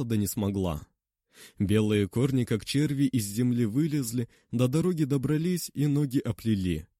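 plain reading voice

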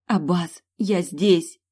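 excited call. Acted. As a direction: call out that you are here. Prisoner calls out to rescuer.

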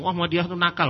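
lightly acted high-pitched tattling; rabble-rouser. Instigator telling neighbours about the Ahmadi, mock agitated. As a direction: mimic someone stirring up a crowd. Acting someone inciting a mob.

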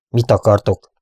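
firm, demanding question; forceful. A narrator's reading. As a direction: demand an answer. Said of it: "kérdezte erélyesen".

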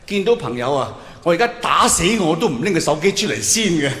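ordinary talk

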